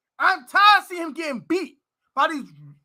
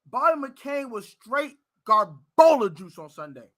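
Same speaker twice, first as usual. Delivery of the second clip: usual speaking voice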